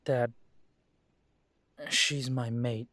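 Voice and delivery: ordinary talk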